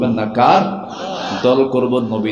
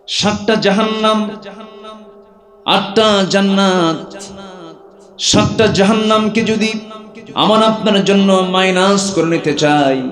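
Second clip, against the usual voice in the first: high, ringing preacher's cry; fiery preaching; 9 semitones higher, 3 dB louder than usual. Acted. Preacher proclaiming the seven hells to the crowd, high and ringing.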